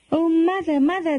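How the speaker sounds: high-pitched call for mother, mildly acted; tattling cry. A narrator's reading. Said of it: "they cried".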